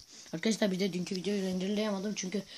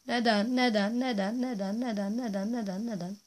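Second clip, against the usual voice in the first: higher, repeated whining; whiny neden; 5 semitones higher, 4 dB louder than usual. Pretend. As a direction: nag in mock despair. (sızlanarak tekrar)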